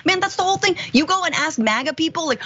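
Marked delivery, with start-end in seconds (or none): none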